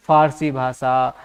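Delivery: ordinary talk, nothing marked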